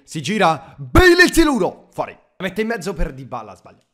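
rising, shouted football commentary; live play-by-play. Acted. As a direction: call the attack like a TV commentator. Calls his own game action.

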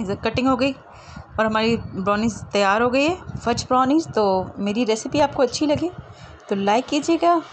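ordinary talk

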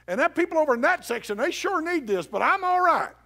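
high, loud challenge to the congregation; full-force preaching. Real, not acted. Preacher presses congregation to admit pride, full-force preaching. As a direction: shout it out like a fired-up preacher.

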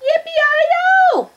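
high, loud yippee holler; cowboy whoop. A narrator's reading. Cowboy heard from Baby's bed, high whoop. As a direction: whoop it out at the top. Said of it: "She heard another".

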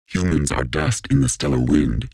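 very deep put-on voice; deep, portentous machine voice; ominous quote. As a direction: drop far down low for an ominous pronouncement. Quotes own attempted ominous line.

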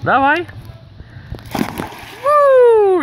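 high, loud cheer for the goose; excited cheering. Real, not acted. (радостно подбадривает, высоко и громко)

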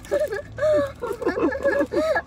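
half-crying giggle; whiny laugh. Real, not acted. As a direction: laugh through frustration. Child laughing, frustrated.

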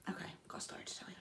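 quick, whispered retold line; hushed aside. Fan tells the star she has a story, hushed. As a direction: rush it out under the breath.

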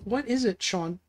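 quiet, unintentionally annoyed question. Real, not acted. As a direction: ask softly with a touch of irritation. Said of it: "that sounded really annoyed".

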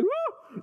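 high, short yelp of fright; startled whoop. Acted. Elijah startled by fire on the mountain, high whoop.

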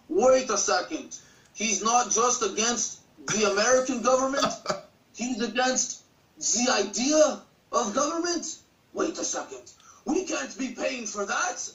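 hammed-up, slow Russian accent; drawn-out mock Russian accent; terrible Russian accent. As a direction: imitate a Russian official in a bad accent. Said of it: "do this line in a Russian accent".

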